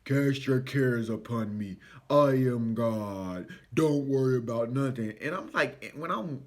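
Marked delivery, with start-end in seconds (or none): 0.1-5.1 deep, slow, solemn voice of God; booming God voice. Retold or imitated. Recalling a scripture, spoken as God. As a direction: intone it like God speaking from above. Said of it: "this scripture where it was like".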